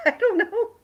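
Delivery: high giggly laugh through the words. Real, not acted. To her stream chat, giggling at the mystery block.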